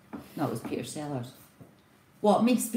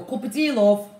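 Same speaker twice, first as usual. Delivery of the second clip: higher, louder Yorkshire call; Yorkshire accent; 5 semitones higher, 6 dB louder than usual. Shown as a demonstration. Showing how she offers husband tea, Yorkshire accent. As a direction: sing out an offer in broad Yorkshire. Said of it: "in your Yorkshire accent".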